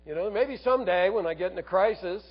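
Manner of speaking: mock someone breezily putting it off; casual brush-off. Voices someone putting off salvation.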